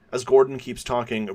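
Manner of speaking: usual speaking voice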